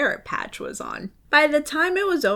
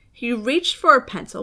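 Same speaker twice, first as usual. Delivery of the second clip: ordinary delivery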